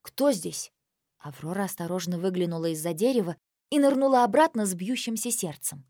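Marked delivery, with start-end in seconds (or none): 0.0-0.7 male voice. Acted. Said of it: "мужской голос спросил".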